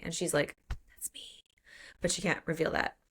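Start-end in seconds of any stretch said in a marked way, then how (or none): 0.7-1.5 barely audible whispered aside; hushed, voiceless confession to oneself; secret whisper. Acted. Character's secret thought, cannot reveal it.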